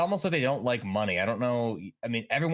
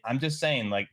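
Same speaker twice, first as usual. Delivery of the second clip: ordinary talk, nothing marked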